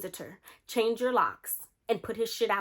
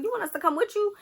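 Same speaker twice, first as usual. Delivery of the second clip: very high, eager challenge; acted riled-up offer; 12 semitones higher, 3 dB louder than usual. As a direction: imitate a friend offering backup, pitch shooting up. Acting friend offering to step in.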